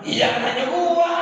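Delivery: a hammed-up sewot reply; mock exasperated. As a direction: snap back at a pestering questioner. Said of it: "Sampai kata".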